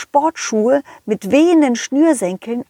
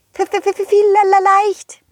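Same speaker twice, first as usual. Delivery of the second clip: scared stutter; 10 semitones higher than usual. Acted. Er hat immer noch Angst.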